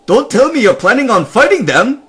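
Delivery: high loud startled nomad; alarmed Nomad. Acted. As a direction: blurt it out in alarm. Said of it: "the Nomad said".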